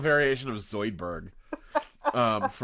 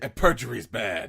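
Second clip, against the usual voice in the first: mock-stern reminder, leaning on BAD; hammed-up admonishing line; retold warning; 6 dB louder than usual. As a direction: imitate a lawyer's pointed warning. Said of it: "line for line repeats what he's saying".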